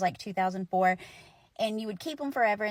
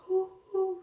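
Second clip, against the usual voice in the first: high hooted train whistle; imitated train whistle; 10 semitones higher than usual. Shown as a demonstration. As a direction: hoot like a wooden toy whistle. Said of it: "just to make it go, like".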